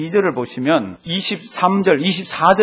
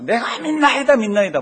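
retold cry; 7 semitones higher, 4 dB louder than usual. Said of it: "아버지는 뭐라 그랬습니까".

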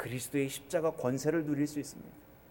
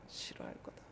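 whisper; 19 dB quieter than usual. Acted. God's warning fades to whisper.

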